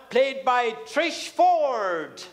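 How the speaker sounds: slow build to a drawn-out name; announcer's introduction. Real, not acted. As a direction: present her to the crowd, stretching the name. MC calls guest onstage.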